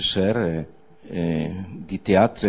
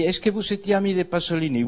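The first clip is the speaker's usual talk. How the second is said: mayor's question; 8 semitones higher, faster than usual. Retold or imitated. Arrabal voices Delanoë's question.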